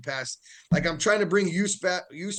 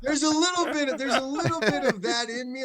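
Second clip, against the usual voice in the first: laughing; 8 semitones higher than usual. Real, not acted. Coach confesses, laughing.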